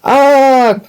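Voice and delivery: high, loud "Ah" cry; children's chorused scream. A narrator's reading. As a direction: scream it out like a chanting class. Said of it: "chorused the children".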